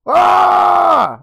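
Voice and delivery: loud, high shriek of terror; terrified scream. Acted. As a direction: scream in horror. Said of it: "Emma screamed".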